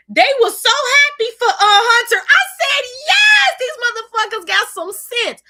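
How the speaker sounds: high, loud joyful shout; overjoyed. Real, not acted. Viewer cheering the announcers' support, high loud joy. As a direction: shout it out thrilled.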